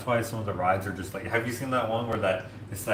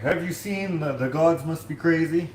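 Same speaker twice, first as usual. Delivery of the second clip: higher-pitched imitated African accent; 6 semitones higher, 3 dB louder than usual. Coworker asking about a movie, imitated African accent. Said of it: "like, African accent".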